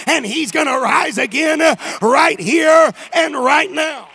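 loud, high preaching climax; full-force preaching. Acted.

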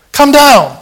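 high, loud jeering cry; taunting crowd yell; mocking shout. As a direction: jeer at him like the mob at the cross. Preacher voicing mocking crowd.

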